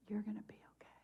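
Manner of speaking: very soft, tender whisper; whispered reassurance. Retold or imitated. Friend comforts the widow after their laughter, whispering reassurance. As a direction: let the comfort drop to a bare whisper.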